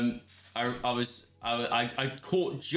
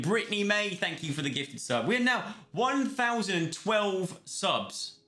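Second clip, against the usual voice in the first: excited; 9 semitones higher than usual. Real, not acted. Thanking viewer, sub count.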